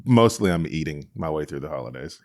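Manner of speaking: ordinary delivery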